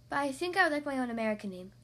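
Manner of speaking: fast, rushed request; girl hurriedly asking her mother for an American name; said quickly. Acted. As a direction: rush it out before she loses her nerve. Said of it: "she said quickly".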